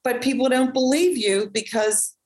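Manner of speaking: ordinary talk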